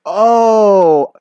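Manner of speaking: high, booming drawn-out cry; loud exaggerated oh. Boy caught out by his crush, loud exaggerated reaction. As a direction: blast it out, hammed-up.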